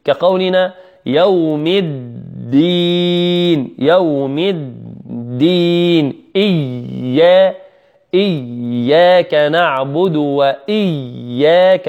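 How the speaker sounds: very slow Quran recitation; slow recitation demo. Shown as a demonstration. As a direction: chant the verses slowly to show the doubling. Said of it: "لابد من بيان الحرف الأول والثاني".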